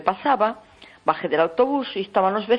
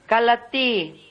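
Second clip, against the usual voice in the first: a higher, mock shouted shut up in Galician; retold yell; 6 semitones higher, much slower than usual. Neighbour screaming at caller to shut up, mock yell. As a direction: bark it like the angry neighbour, half-hearted. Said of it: "Y empezó a gritar".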